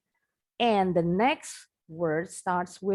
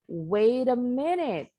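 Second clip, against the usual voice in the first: American wait a minute, the T of wait softened to a quick D; American flap T; 4 semitones higher than usual. Shown as a demonstration. Teacher demonstrates the American wait a minute for the class. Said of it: "T-nya bunyinya seperti D cepat".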